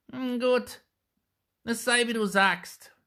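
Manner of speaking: put-on high, loud old hag voice; grim witch. A narrator's reading. Old witch grimly agreeing with the mother, high and loud. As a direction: give in with grim menace. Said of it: "rief die Alte mit grimmigem Blick".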